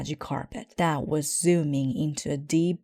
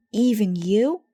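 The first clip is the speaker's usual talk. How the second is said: high, louder startled question; surprised question; 5 semitones higher, 6 dB louder than usual. Acted. Brother asking Papa in surprise, high and loud. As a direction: ask in disbelief. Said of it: "asked Brother".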